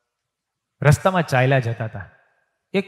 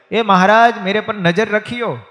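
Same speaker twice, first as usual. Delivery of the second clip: louder, higher call after a passing rider; 7 semitones higher, 7 dB louder than usual. Retold or imitated. Vendor woman calls after departing Maharaj, raised call. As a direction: call out so he hears you as he rides off.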